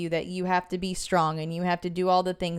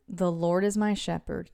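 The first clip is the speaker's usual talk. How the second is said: slowed-down reading; 5 dB quieter than usual. Shown as a demonstration. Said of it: "Slow down".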